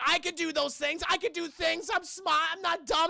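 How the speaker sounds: mock defensive boyfriend insisting he's smart; defensive insistence. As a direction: prove to her he is capable. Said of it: "Sean's like".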